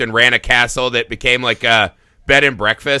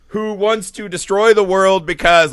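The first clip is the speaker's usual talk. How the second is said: usual speaking voice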